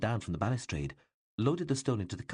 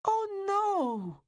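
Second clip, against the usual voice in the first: high, loud shriek of dismay; a boy seeing his lake hit; panicked boyish cry; 4 dB louder than usual. A narrator's reading. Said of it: "The boy shrieked".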